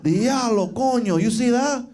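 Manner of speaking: hyped-up Dominican street voice; excited cab driver. Retold or imitated. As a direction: egg him on to chase her. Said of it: "he was like".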